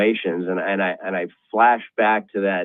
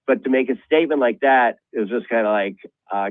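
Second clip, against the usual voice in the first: ordinary talk, nothing marked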